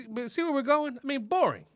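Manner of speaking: ordinary talk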